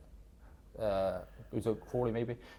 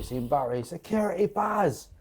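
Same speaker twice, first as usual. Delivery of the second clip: put-on Manc accent shout; 7 semitones higher than usual. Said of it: "In his Manc accent".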